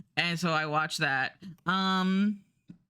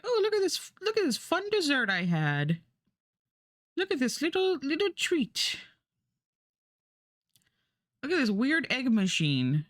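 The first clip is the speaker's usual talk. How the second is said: high, gushing show-and-tell voice, much higher; mock cutesy food-video host; 8 semitones higher than usual. Vlogger imitating food videos, high cutesy gushing voice. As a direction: gush over each treat like a cute eating video. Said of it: "it's just like".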